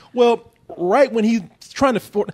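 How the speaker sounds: ordinary delivery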